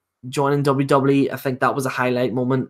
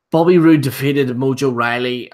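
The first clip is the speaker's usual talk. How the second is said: ordinary delivery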